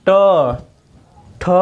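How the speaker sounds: ट and ठ said alone with the tongue curled back; teacher showing ट ठ again; retroflex demo. Shown as a demonstration. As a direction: sound out ट ठ crisply, one at a time. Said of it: "मुड़कर कहाँ स्पर्श कर रही है".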